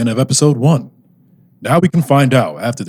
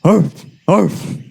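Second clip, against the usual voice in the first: dog barks; 5 semitones higher than usual. Acted.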